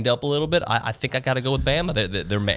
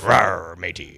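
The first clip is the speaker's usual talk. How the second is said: pirate voice; 5 semitones lower, 3 dB louder, much slower than usual. Pretend. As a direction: ham it up like a cartoon pirate. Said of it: "Why did I do a pirate voice?".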